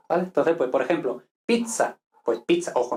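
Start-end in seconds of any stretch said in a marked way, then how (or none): 1.5-2.7 pizza with a crisp Italian "ts", pitsa. Shown as a demonstration. Teacher models Italian for Spanish learners, pizza as pitsa. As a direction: say pizza with a long t-s sound, not ch. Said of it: "no pizza".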